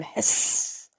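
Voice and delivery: a sharp, whispered hiss like a spider; sharp inhuman hiss. A narrator's reading. Spider-boy hissing at Sam in fight, sharp hiss. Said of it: "let out a sharp inhuman".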